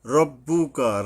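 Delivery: "রব্বুকা" with a full, rounded "ro"; heavy-r model "রব্বুকা", firmly voiced; correct heavy R. Shown as a demonstration. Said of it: "রব্বুকা হবে".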